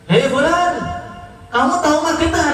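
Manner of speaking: high, quick hail and question; calling out to a patient. Acted. Tabib memanggil dan bertanya.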